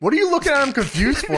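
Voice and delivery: high, quick mock indignation; indignant. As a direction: fire back as an affronted worker. Voicing indignant stagehand at work.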